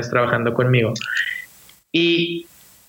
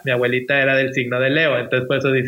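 ordinary talk, nothing marked

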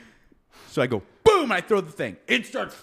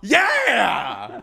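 excited yeah; 4 semitones higher, 5 dB louder than usual. Real, not acted. Celebrating, laughing.

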